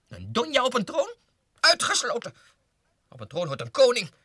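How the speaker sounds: high, loud indignant yell; outraged shout. Acted. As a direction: explode with indignation. Outraged at a queen. (verontwaardigd geschreeuwd)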